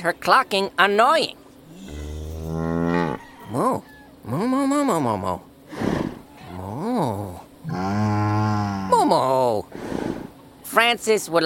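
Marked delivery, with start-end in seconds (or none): 1.8-9.6 cow mooing. Acted. Bull voicing his apology.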